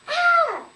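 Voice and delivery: high, very loud scream; piercing acted scream. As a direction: shriek out in sudden alarm. Mother's scream imitated.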